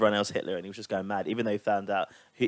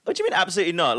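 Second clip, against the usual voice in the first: higher, incredulous challenge; 7 semitones higher, 3 dB louder, faster than usual. Real, not acted. Challenging a friend who won't watch All Stars, incredulous. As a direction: call out their answer in disbelief. Mock outrage at friend's refusal.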